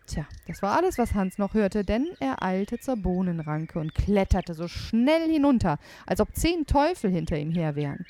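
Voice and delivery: ordinary delivery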